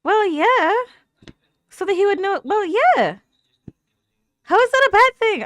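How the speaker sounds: high, loud exasperated retort. Real, not acted. Viewer yelling back at Zeke on screen, exasperated. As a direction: snap back in disbelief at the screen.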